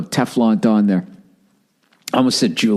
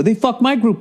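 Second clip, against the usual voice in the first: much higher whine, stress on "my"; mock whiny complainer; 12 semitones higher than usual. Comic mimicking cheated fans, high whining. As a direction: whine "my" like your group got robbed. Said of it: "just us complaining".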